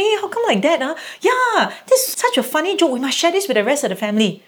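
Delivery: high, bright-eyed joke reaction; animated delight. Retold or imitated. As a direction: gush over the funny video. Mom replying to son's joke.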